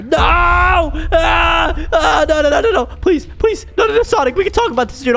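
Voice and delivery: shrieking no no no in panic; panicked screaming. Acted. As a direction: scream and beg for your life. Cornered by Sonic, screaming.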